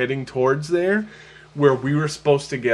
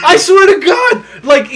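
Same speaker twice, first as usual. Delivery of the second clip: exasperated shout; 11 dB louder than usual. Real, not acted. Insisting loudly in disbelief.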